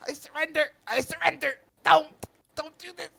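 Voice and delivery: very high, frantic pleading; loud desperate cries, dropping at the end; panicked surrender. Acted. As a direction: beg for mercy in a squealing, high voice. Captive surrendering to his attacker.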